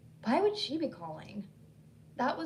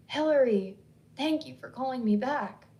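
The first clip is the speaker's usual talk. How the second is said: urgent, first word louder; 3 semitones higher, 7 dB louder than usual. Acted. Sinnedy relieved Hillary called back, urgent. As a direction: press the thanks with urgency. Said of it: "with urgency in her tone".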